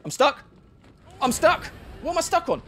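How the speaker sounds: high, loud frantic yelps; flailing in panic; rising frightened cries; panicky. Real, not acted. Panicking when stuck in game.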